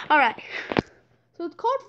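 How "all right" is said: ordinary talk, nothing marked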